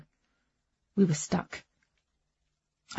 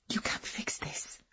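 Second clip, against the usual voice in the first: breathy unvoiced whisper; 12 dB quieter, slower than usual. A narrator's reading. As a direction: whisper it in despair. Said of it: "she whispered".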